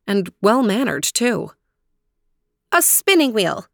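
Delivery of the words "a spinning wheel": high-pitched princess voice; princess Aurora. A narrator's reading. Aurora telling the stranger what she needs, high. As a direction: answer high, as the young princess. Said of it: "said Aurora".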